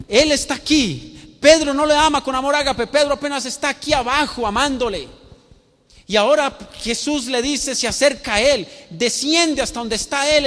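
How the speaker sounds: high, loud pulpit delivery; impassioned preaching. Acted. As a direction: drive each phrase home to the whole congregation. Preacher at full force.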